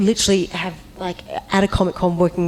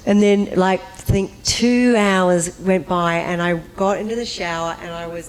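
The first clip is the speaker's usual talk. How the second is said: ordinary talk, nothing marked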